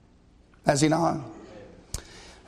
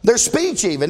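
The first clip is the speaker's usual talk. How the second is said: ordinary talk, nothing marked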